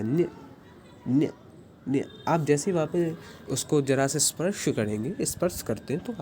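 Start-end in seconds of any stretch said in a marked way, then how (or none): 0.0-2.1 न्य repeated as a palatal "nya", each one separate; ny drill. Shown as a demonstration. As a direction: say न्य three times with the tongue touching the palate. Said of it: "ऐसा इसका उच्चारण होता है".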